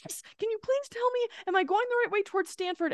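high-pitched, hurried plea for directions; lost driver begging for help; frantic asking. Retold or imitated. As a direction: ask in a high, desperate rush.

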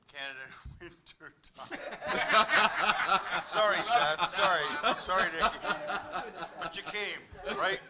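laughing through the words; giggling sorry between laughs; laughing apology. Real, not acted. Laughing at own joke.

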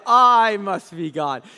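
loud, slow smug cat. Retold or imitated. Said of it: "impressed and enamored with himself".

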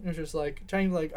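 ordinary talk, nothing marked

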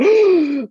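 sharp high gasp; loud theatrical gasp; mock crowd gasp. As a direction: gasp like a shocked crowd at a pawn move. Acting crowd gasping at chess move.

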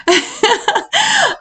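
high-pitched giggle. Real, not acted. Laughing after trying out British slang, high giggle. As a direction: burst of amused laughter. Amused by own imitation.

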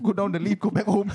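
gleeful delivery breaking into a laugh; laughing punchline. Retold or imitated. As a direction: crack up while telling the escape. Laughing punchline of escaping interview.

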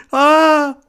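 put-on high loud scream of a panicked kid; imitated shriek, full volume; retold scream. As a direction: let out a sudden high yell. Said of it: "Eu comecei tipo".

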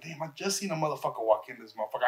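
low, hushed and quick, talking to himself; muttered under his breath. Retold or imitated. Alone in the empty RV, sure someone's hiding, hushed mutter. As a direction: keep it under your breath, wary.

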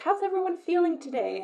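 lightly mimicked team check-in; upbeat meeting host. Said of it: "we've had work talks where they've been like".

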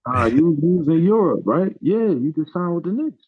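high, loud mimicry of an eager fan; excited recognition. Acts out Tracy Morgan recognising him.

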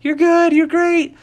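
high-pitched, eager encouragement; reassuring coach. Acted. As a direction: cheer up an upset child in a high voice. Coach consoling a crying child.

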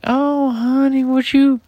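high, loud put-on wife voice; mimicking wife calling out sweetly; imitating wife. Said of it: "And she was like".

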